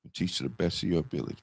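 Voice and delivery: a low, soft, fading voice; dying teacher's advice. Retold or imitated. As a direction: pass on her last wish gently.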